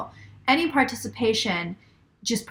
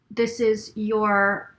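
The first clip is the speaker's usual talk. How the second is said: usual speaking voice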